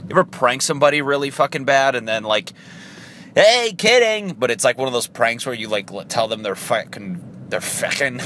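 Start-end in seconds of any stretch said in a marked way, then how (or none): 3.3-4.3 high, loud, slow sing-song "Hey, kidding"; mock kidding. Prankster to victim, high sing-song reveal. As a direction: chirp it out like it was all a joke.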